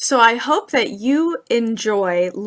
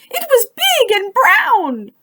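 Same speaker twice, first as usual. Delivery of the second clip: squeaky, much higher, fast little mouse voice; a tiny mouse blurting out what it saw; frightened mouse; 13 semitones higher, 5 dB louder than usual. Acted. Mouse warns the animals, squeaky frightened voice. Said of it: "cried the mouse".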